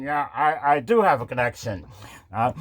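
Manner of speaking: a meek, lightly voiced Biden; feeble old man. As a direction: mumble like a lost old man. Biden answers weakly.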